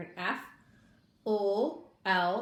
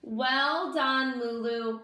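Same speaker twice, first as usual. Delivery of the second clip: proud mother; 4 semitones higher, 5 dB louder, much slower than usual. Acted. Mother praising Lulu.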